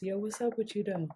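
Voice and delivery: low, soft, confident pickup line; smooth low pickup line. Shown as a demonstration. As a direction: lay the riz on low and smooth.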